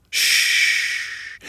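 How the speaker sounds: long unvoiced shushing; urgent hush. A narrator's reading. Muskrat afraid Nag will hear, hushing. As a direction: hush the listener.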